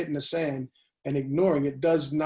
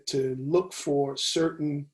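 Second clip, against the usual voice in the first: ordinary delivery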